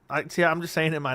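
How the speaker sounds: ordinary talk, nothing marked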